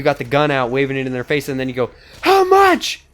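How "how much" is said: high, loud shouted demand; high yelled demand. Retold or imitated. Robber yelling at the clerk, high shout. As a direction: yell it at the cashier, gun out.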